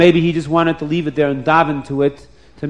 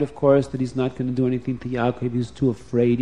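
usual speaking voice

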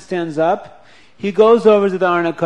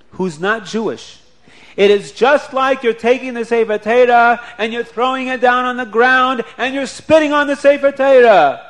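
forceful rebuke; 5 semitones higher, 6 dB louder than usual. Acted. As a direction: drive the lesson home hard. Rabbi delivers the moral forcefully.

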